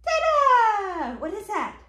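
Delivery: high, loud Dada sliding down; big playful singsong cheer; exclaimed refrain. Acted. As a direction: belt it out high for baby. Parent cheers book's refrain to child.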